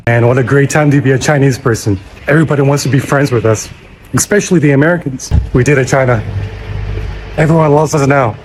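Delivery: triumphant shout. Acted. Vlogger cheering to viewers that China won, shouting loud. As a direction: crow it out like a victory cheer.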